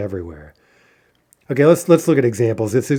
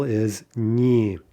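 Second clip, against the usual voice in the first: ordinary talk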